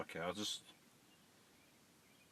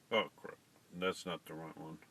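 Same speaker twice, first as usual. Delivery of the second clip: ordinary talk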